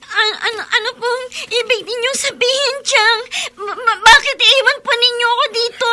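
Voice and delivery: half-laughing, half-sobbing in rising fear; panicked crying. Acted. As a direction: plead through tears as the truth sinks in. Girl realizes she is abandoned.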